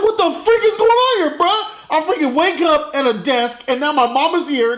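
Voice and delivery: high-pitched, incredulous rant; bewildered outburst. Acted. Hero waking at a desk, acted high bewilderment. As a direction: start high in disbelief, ease down as you go.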